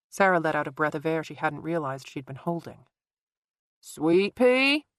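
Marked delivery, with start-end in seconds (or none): 3.8-4.9 high call down the stairs; calling upstairs. A narrator's reading. Father calling down from upstairs, high and far. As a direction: call her from a distance. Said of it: "Eddie called from upstairs".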